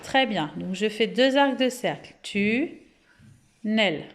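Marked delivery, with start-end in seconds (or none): 2.2-4.1 "Tunnel" slowly as tu, long pause, nel; slow, detached "Tu-nnel"; syllable by syllable. Shown as a demonstration. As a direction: split "Tunnel" into two held syllables. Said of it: "deux syllabes".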